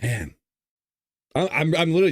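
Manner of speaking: real, trying not to cry; holding back tears. Real, not acted. Host admitting he's near crying on camera, holding back tears. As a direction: stumble over the words, fighting tears. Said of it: "trying not to cry on camera".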